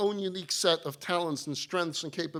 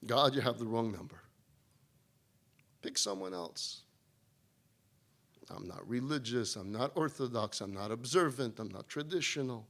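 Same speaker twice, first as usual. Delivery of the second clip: much softer, lower, brushing God off; muttered excuses; 9 semitones lower, 8 dB quieter than usual. Acted. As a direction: mumble the excuses away, half-hearted. Dismissive reply to God, voiced.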